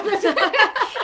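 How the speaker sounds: loud laugh. Real, not acted. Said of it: "laughs after the impression".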